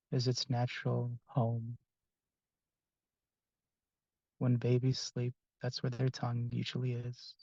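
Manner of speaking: soft, unhurried meditation voice with long pauses; guided meditation. Acted. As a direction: gently guide the group into relaxation, phrase by phrase.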